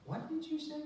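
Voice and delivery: mock disbelief from his wife; surprised wife. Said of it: "She goes".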